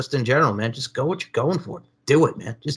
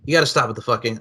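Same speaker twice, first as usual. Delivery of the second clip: ordinary talk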